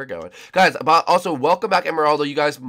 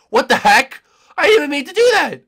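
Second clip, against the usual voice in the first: startled shout; 13 dB louder than usual. Real, not acted. Startled by hidden friend.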